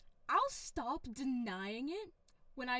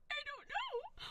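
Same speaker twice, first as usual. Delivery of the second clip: squeaky reply; 11 semitones higher than usual. Pretend.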